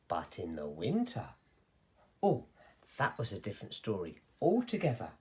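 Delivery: low, soft and dark; hushed storyteller warning; ominous hush. A narrator's reading. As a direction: drop the voice to foreshadow trouble. Ominous shift to winter.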